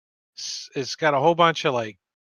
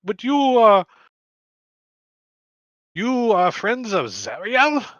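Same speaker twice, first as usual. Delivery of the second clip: put-on high, slow Indian-accented question; 9 semitones higher, 7 dB louder than usual. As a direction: probe slowly in a raised Indian accent. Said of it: "he's talks in an Indian voice".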